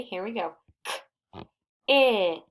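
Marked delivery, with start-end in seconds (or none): 1.9-2.4 sounds split and punched: K-it; sounded out. Shown as a demonstration. Teacher to class, tapping out the sounds. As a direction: tap out each sound of K-it, loudly. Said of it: "Point and say".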